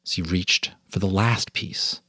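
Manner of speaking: ordinary delivery, nothing marked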